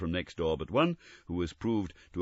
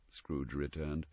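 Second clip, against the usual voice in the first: ordinary delivery, nothing marked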